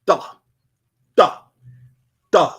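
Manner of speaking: Duh struck hard, a D with a J edge; hard D J sound. Shown as a demonstration. Teacher drilling the hard D J sound, hard duh. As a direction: spit out Duh, Duh, Duh. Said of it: "This is how we say Natharu".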